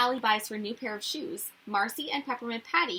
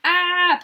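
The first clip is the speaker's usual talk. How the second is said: loud, higher yell of dismay; frustrated yell; 8 semitones higher, 12 dB louder than usual. Acted. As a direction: yell at another ruined batch. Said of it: "Peppermint Patty yells".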